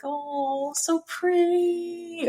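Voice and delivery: high, slow dreamy "so pretty"; swooning at pretty music; mock swoon. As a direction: gush over it.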